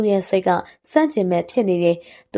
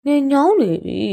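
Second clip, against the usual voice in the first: put-on higher, whining girl's voice; whiny complaint; 6 semitones higher than usual. Tired girl to older companion on the walk, whining. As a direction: complain like a tired little sister.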